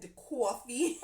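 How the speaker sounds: a hint of a Brooklyn coffee order; lightly imitating a Brooklyn New York accent; a touch of Brooklyn in the coffee. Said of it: "she says it's with that".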